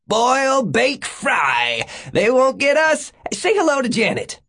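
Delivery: high-pitched shout over the shoulder; calling back. Acted. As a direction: yell it gleefully high to someone running behind. Fugitive calls back while running off.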